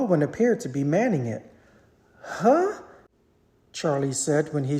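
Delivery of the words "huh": high, puzzled huh; a startled high grunt of confusion; surprised huh. A narrator's reading. Charlie spotting the unmanned door, puzzled.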